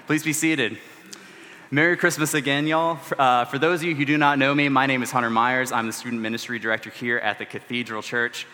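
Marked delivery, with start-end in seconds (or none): none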